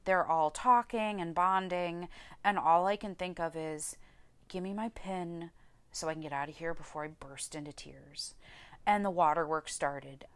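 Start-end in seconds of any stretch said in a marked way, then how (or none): 4.5-8.3 softer, dropping away at the end; muted inner plea. Retold or imitated. Nursing graduate silently begging for her pin, muted inner plea. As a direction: think it quietly to yourself, wanting out.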